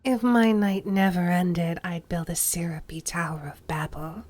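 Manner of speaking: breathy whispered seductive vampire. Acted. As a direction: hush it like you're about to bite. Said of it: "it's a little breathy".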